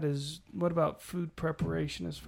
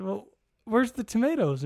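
mock exasperated question; 7 semitones higher, 6 dB louder than usual. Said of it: "I said".

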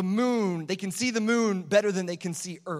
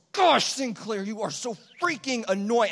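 mock growl, louder, a little higher; 4 semitones higher, 4 dB louder than usual. Tired dad growling at his crying newborn, mock growl. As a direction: growl it like a grumpy bear. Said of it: "in this growling voice".

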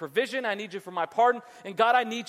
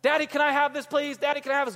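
put-on higher, louder begging kid voice; pestering child; 6 semitones higher, 4 dB louder, faster than usual. Child begging Daddy for a treat, pestering. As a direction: nag Daddy insistently like a kid.

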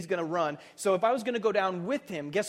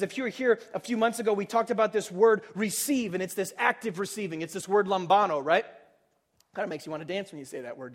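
normal talking voice

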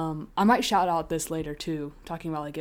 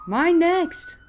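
excited; 10 semitones higher, 6 dB louder than usual. Real, not acted. Eager to go next.

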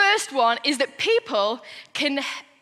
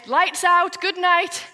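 loud, high parental call; parent at bedtime; 6 semitones higher, 5 dB louder, slower than usual. Acted. Parents sending children to sleep, loud calling voice. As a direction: call lights-out through the house. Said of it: "And Mum and Dad say".

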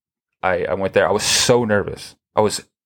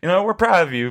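mock encouragement; 13 semitones higher than usual. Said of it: "They're like".

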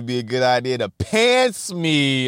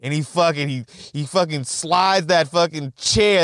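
ordinary talk, nothing marked

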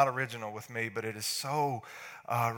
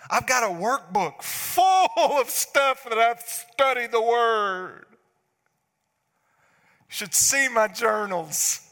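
loud, higher, slower bragging; boastful excuse; 7 semitones higher, 6 dB louder than usual. Retold or imitated. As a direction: show off the journals proudly.

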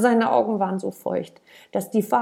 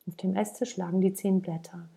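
usual speaking voice

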